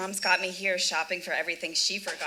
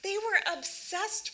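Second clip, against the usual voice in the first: surprised; 10 semitones higher, slower than usual. Acted. Disbelief at friend's modesty.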